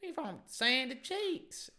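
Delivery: high, loud, drawn-out Texan drawl; hammed-up Texan girl accent; Texan woman accent. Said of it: "That's, like, Texan".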